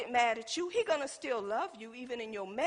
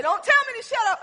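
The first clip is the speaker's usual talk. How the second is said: high, loud indignant retort; indignant husband; 8 semitones higher, 7 dB louder, faster than usual. Retold or imitated. Husband snapping back at his wife in the car, loud and indignant. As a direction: throw it back at her, offended.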